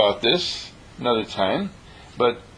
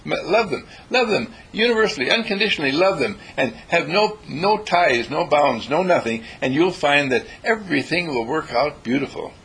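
ordinary talk, nothing marked

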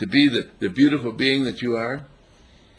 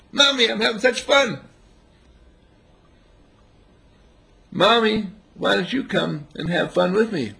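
put-on higher, eager child calling mommy; happy little child; 6 semitones higher than usual. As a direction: play a delighted kid begging mom to join. Child voiced calling to mother.

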